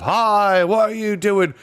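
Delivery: high, loud warning cries; dwarfs crying out. A narrator's reading. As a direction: shout at someone to drop the sword. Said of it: "Jill heard cries of".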